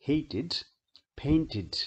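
"hated", "fainted" with -ed said as a separate "id". Shown as a demonstration. Teacher models example words for students, -ed pronounced "id". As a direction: end "hated" and "fainted" in a clear extra syllable "id". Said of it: "तो आखिरी ED को इड बोला जाता है".

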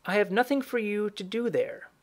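lightly played old woman instructing the mother; old woman character. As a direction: voice the old woman a little high.

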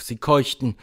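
plain reading voice

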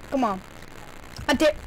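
ordinary delivery

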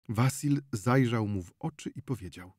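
ordinary delivery, nothing marked

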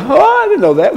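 high-pitched feigned innocence; mock-surprised who-me voice; playing dumb. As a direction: act as if you had no idea. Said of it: "We play dumb".

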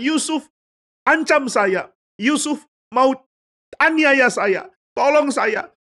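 high-pitched cries for help; hammed-up victim shouting high; crying out. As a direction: call out in feigned alarm. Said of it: "Dia teriak-teriak mengatakan".

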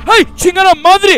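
screaming out in a jump scare; startled yell. Real, not acted. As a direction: yell it in sudden fright.

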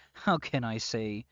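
ordinary talk, nothing marked

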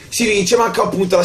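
high, bright cheer of victory; excited. Real, not acted. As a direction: shout the win with joy. Repeats the win, excited.

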